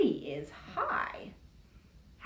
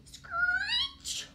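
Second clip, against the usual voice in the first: very high slow screech; screeching tyres sound; brake screech. Acted. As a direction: stretch the brake squeal. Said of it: "went the brakes".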